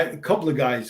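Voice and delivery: normal talking voice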